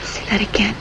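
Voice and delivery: breathy lover's whisper. Pretend. Pretend lovers' scene.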